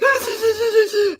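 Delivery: high choked gasp, close to crying; imagined sobbing gasp at a sad movie; mock tearful gasp. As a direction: gasp as if tears are coming. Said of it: "I'd be like".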